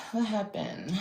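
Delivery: ordinary delivery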